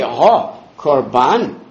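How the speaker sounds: "Ha korban" slow, leaning on "Ha"; slow, deliberate "Ha korban"; stressed ha. Shown as a demonstration. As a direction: drag out "Ha" before "korban". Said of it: "If you say".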